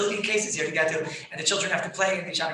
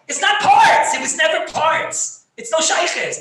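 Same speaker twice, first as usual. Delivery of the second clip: much higher and louder, insisting through laughter; laughing shout; 8 semitones higher, 6 dB louder, slower than usual. Real, not acted. Rabbi shouting his point to the class, laughing. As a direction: hammer the point home, half laughing.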